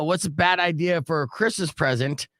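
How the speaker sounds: ordinary talk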